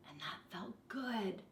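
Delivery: soft whisper. A narrator's reading. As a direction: breathe it out as a quiet secret. Narrator's hushed close.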